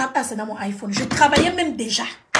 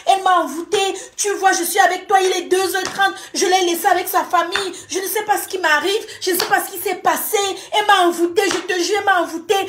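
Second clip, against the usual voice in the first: higher, pleading excuse voice; pleading boyfriend; 5 semitones higher than usual. Retold or imitated. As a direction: voice him begging, bewitched. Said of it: "Il me dit, pardon".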